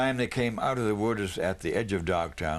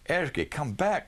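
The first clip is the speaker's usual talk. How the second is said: put-on higher call after a child; 7 semitones higher than usual. Mother calling her son back inside, raised call. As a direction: call him back from the door. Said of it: "called Mother".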